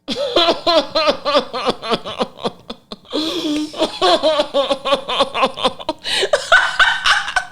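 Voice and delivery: long nervous laughing fit; can't stop laughing, embarrassed. Real, not acted. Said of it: "That was quite a hearty laugh there".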